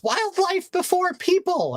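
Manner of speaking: very high, slow squeaky mock shout; lady shouting. Boater lady shouting at the jet skier, very high mock. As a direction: squeal the slogan like the angry lady. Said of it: "she shouted back to him".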